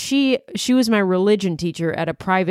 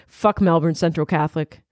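ordinary talk

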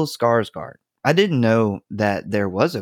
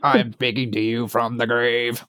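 Stephen Hawking voice. Retold or imitated. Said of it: "would you practice Stephen Hawking".

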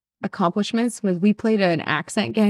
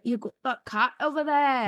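higher, softer accented impression of a TV host; Ian; 5 semitones higher, 5 dB quieter than usual. Love Island host pointing out a cat, imitated accent. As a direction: imitate a comedian narrator's accent. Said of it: "what's his name from Love Island".

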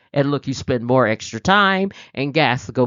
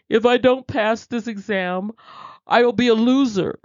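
higher, anxious inner voice; panicked thinking; 7 semitones higher than usual. Retold or imitated. Student fearing a failed exam, panicky and higher. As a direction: voice the worried student spiraling. Voicing catastrophizing exam thoughts.

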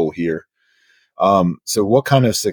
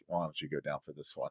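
soft suggestion; 3 semitones lower, 18 dB quieter than usual. Retold or imitated. Dad softly hands over to wife.

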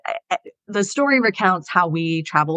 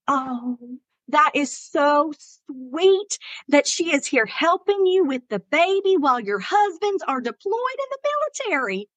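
put-on thick Southern accent; 8 semitones higher than usual. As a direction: gush like a Southern lady, stretching the words. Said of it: "helps capture the essence to do her accent".

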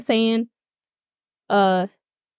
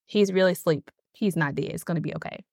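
soft, lower, growing quieter; gentle reassurance; 5 semitones lower, 3 dB quieter than usual. Retold or imitated. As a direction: soothe them softly, it's okay. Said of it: "but Jesus is like".

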